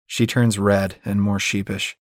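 plain reading voice

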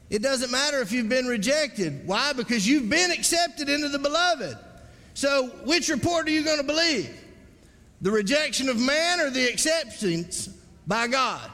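high, urgent pulpit voice; preacher's exhortation pitched well up; impassioned preaching. Real, not acted. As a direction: press the challenge on the congregation.

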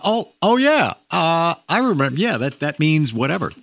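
high-pitched mock realization; dawning recognition. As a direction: act out a client suddenly remembering. Imagined client suddenly remembering a message.

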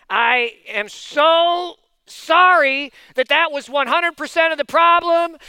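very slow, stretched-out mock apology; laboured, emphatic fake contrition; overdone grudging apology. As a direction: drag out each word of the apology. Grudging apology with drawn-out words.

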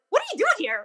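retelling the shock of spotting a familiar face; surprised. Retold or imitated. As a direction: blurt it out in amazement. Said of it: "I'm like".

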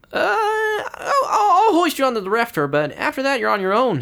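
high, timid monk's voice; reluctant helper voice, high and loud; nervous Brother Michael. Acted.

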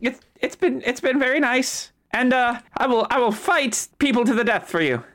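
character voice. Acted. NPC pledges loyalty in character.

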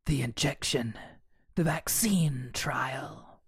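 slow sinister whisper; creepy whispered explanation. Acted. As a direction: whisper each word slowly.

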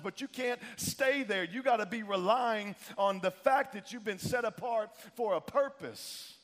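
impassioned pulpit exhortation; fired-up preaching. Real, not acted. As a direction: preach it hard with punched key words. Preaches at rising force.

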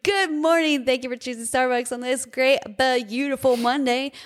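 chirpy barista welcome; super happy drive-thru greeting. Retold or imitated. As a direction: greet them like it's the best morning ever.

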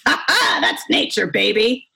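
hammed-up passer-by voice; Telly Savalas. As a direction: imitate a smooth dude cheering someone on. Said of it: "Telly Savalas voice".

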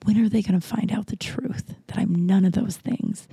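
hushed whisper of self-doubt; whispered inner voice. Retold or imitated. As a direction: breathe it out as a secret fear. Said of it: "in my head, I think".

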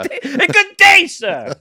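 high, loud, laughing British sign-off; hammed-up British farewell. Giant slayer storming off, loud hammed-up British "good day". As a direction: bellow a huffy knight's goodbye. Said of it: "he walks away going".

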